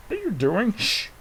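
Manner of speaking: high, startled squeak of a question; squeaky toy voice. Pretend. Toy character interrupting the silence, high squeak. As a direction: pop it up high like a small creature.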